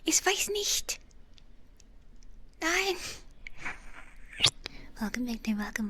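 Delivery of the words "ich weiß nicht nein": playful high German. Acted.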